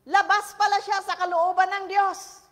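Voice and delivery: loud, high preaching burst. Acted. Pastor preaches to congregation at full force. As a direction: preach it out to the crowd.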